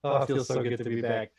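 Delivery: normal talking voice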